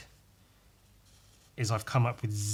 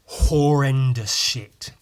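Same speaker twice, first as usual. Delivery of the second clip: slow, loud; emphatic; 8 dB louder, much slower than usual. Real, not acted. Condemning the American remake, slow loud disgust. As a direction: drag it out with disgust. Trashing the show.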